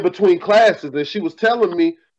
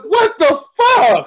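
mock outrage; 8 semitones higher, slower than usual. Retold outrage at friend's remark.

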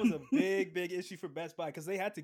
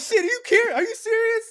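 much higher and louder, disbelieving yell; amazed shout; 12 dB louder than usual. Retold or imitated. People online reacting to free games, loud amazed yell.

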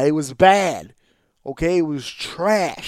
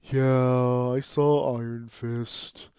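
softer, slow, embarrassed confession; ashamed admission; 4 semitones lower, 4 dB quieter, much slower than usual. Retold or imitated. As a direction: admit it like you're ashamed. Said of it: "it's almost like with shame".

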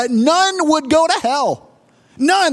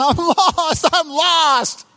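imitated high, tearful wail; hammed-up lost child's cry; hysterical crying; 5 semitones higher, slower than usual. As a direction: act out yelling through sobs. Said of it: "I was crying and I was hysterical".

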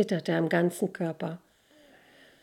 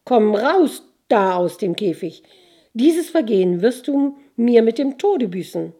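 angry threat; 3 semitones higher, 6 dB louder than usual. A narrator's reading. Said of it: "rief Jelena".

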